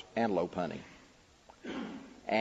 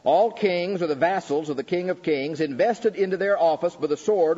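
raised preaching repeat, "All" stressed, a bit less intense; firm restatement; 7 semitones higher, 5 dB louder than usual. Acted. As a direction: restate the point firmly to the hall.

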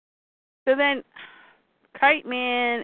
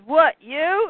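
surprised Joker; 7 semitones higher, 4 dB louder than usual. Retold or imitated. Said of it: "Joker looks up and goes".

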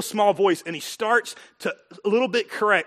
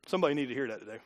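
ordinary talk, nothing marked

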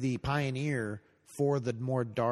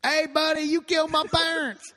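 high, loud Southern twang; [name] voice; 6 dB louder than usual. Pretend. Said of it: "[name]".

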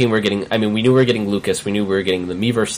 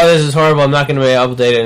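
dismayed; 5 semitones higher, 5 dB louder, much faster than usual. Retold or imitated. Retold own dismay.

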